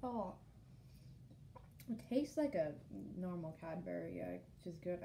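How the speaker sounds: talking while chewing; muffled by food; mouth full of chocolate. Real, not acted. Seen 1: Bitten egg in hand; mouth working right after bites while talking.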